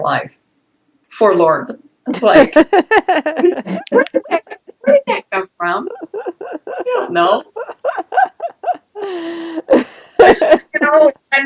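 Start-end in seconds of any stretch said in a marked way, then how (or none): 2.1-4.6 high, slow words breaking into laughter; helpless laughter. Real, not acted. Client cracking up at own word "forlorn", helpless laughter. 4.8-11.4 high, squeaky words through giggles; helpless laughter. Real, not acted. Client baffled by own word choice, laughing helplessly.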